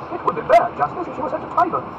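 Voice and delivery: high, loud lordly verdict; ringing aristocratic scorn; scornful retort. Acted. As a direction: condemn her as an affronted Duke. Duke retorts in the quarrel.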